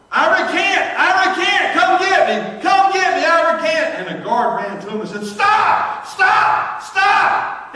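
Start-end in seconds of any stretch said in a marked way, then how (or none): 0.1-3.9 much higher, loud frantic yelling; desperate holler. Retold or imitated. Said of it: "he hollered". 5.2-7.7 much higher, loud repeated cry; urgent shout. Retold or imitated. Guard runs shouting at prisoner.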